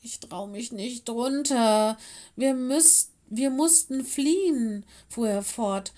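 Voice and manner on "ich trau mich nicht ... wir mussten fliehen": high, loud, whimpering distress; slow, upset and halting, pitched high; tearful. A narrator's reading. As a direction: wail it as if close to sobbing. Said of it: "begann zu schluchzen und zu weinen".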